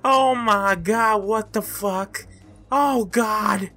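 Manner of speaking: high, loud, slow oh my god; jolted by a jump scare; startled shout. Real, not acted.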